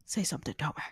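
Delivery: hushed, voiceless whisper. Real, not acted. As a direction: drop to a soft whisper. Whispers to the ghost.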